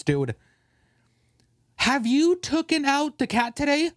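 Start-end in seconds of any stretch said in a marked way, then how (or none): 1.8-3.9 much higher, nagging mock girlfriend voice. Imitating a girlfriend inventing chores for her boyfriend, high and nagging. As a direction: pitch it up like a nagging partner.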